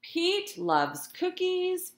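usual speaking voice

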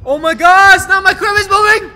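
high, very loud startled cry; shocked outburst at full volume; alarmed shouting. Real, not acted. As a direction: yell it in a panic. Crib moves in horror game.

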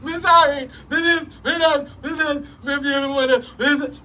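lightly voiced whiny parody of the artist; mocking complainer. Mocking the complaining artist, light whiny parody. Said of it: "more song and complain than you".

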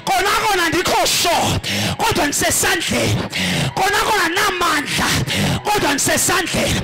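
loud, high, impassioned sermon shouting; preaching at full force. Acted. Preacher pleads with the congregation to stay in God's hand, shouting. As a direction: cry it out to the congregation with everything. Preacher climaxes over music.

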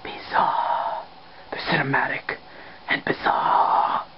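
breath-only whisper, relishing it; savouring whisper. Acted. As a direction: whisper it, drawing out the last syllables. Host savours the band's name.

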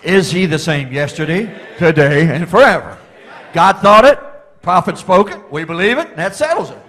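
loud, high, ringing preacher's cry; full-throated pulpit preaching; preaching at full force. Acted. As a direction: shout it out to the church like a revival preacher. Preaching exclamation to congregation.

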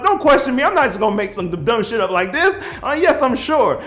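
loud, high indignant retort; worked-up, defensive scolding; mad at being questioned. Retold or imitated. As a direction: insist angrily that you're certain. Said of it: "getting mad at them now for asking me".

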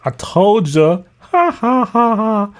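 drawn-out mocking read of the comment; mocking commenter. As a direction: stretch it out like a taunting reply. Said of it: "you have to do the side laugh".

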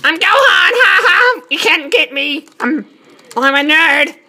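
high-pitched mock nerd kid; hammed-up squeaky young hero, taunting; Gohan. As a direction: put on a high, gleeful child-hero voice. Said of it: "My perfect Gohan impression".

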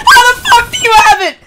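screaming the same question again, high and loud; furious shout. Real, not acted. Streamer repeating his outrage at an opponent, furious shout. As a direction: yell it at the screen in disbelief.

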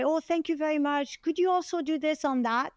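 high, sweetly courteous ask; polite request. Retold or imitated. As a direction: imitate a very polite online commenter. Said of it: "you had to be polite".

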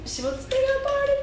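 high, quick amused question; amused surprise. Real, not acted. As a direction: tease back in disbelief. Mother echoes, high amused.

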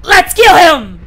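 high, very loud attack shout; hammed-up war cry; battle cry. Friends burst in to attack.

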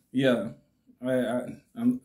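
usual speaking voice

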